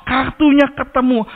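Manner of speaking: high, triumphant mock call of discovery; proud announcement. Son tells his brother the lost card is found, proudly calling out. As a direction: imitate a boy proudly calling out, high-pitched. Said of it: "Dia dengan bangga bilang".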